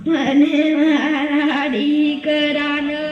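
slow, drawn-out acted lament; tearful wailing. Old mother begging someone to read her son's letter, tearful wail. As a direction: wail it slowly, stretching the words. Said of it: "શા માટે રડો છો?".

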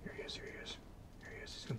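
hushed whisper, barely breathing; fishing whisper. Real, not acted. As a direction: whisper so the fish won't spook. Watching fish approach the lure.

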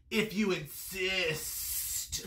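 very slow, sibilant snake; long, drawn-out serpent reply. A narrator's reading. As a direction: stretch the s like a snake. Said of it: "said the snake".

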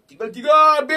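high, loud panicked cry; alarmed shout. Real, not acted. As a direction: cry out at the shrinking balance. Balance dropping. (teriak panik)

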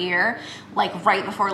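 talking with strips on teeth; slight lisp from strips; whitening strips on teeth. Real, not acted. Seen 3: Holds strip box, then appears to peel a strip off her teeth near 6.2 s.

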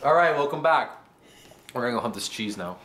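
ordinary delivery

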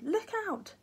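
high urgent warning; warning cry. A narrator's reading. Jack warns his grandfather of the falling nurse, high cry. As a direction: call out in alarm. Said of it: "the boy cried".

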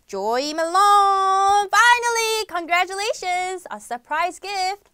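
loud, high winner reveal with the name drawn out slowly; game-show host crowning a winner; excited announcement. Real, not acted.

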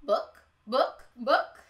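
clucking hen. Acted. Barnyard delights, the hens cluck joyfully.

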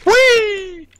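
high, loud, drawn-out "whee"; long playful whee; gleeful whee. Real, not acted. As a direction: squeal it out as you fly through the air. Gleeful cry while jumping in game.

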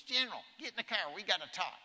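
high, loud, fast presidential order; President Bush impression. President orders the general into the car, high and loud. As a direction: bark it out like a brisk commander in chief. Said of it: "That's how he sounded".

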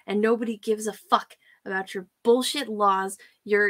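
ordinary delivery

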